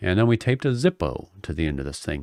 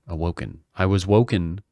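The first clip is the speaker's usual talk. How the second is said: ordinary talk, nothing marked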